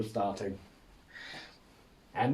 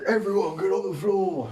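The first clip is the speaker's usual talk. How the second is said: robber's shout; 9 semitones higher, 4 dB louder than usual. Pretend. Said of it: "and be like".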